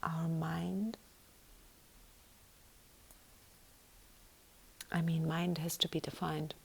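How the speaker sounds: soft breathy whisper throughout. Real, not acted. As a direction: whisper each thought gently.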